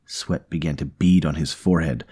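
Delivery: usual speaking voice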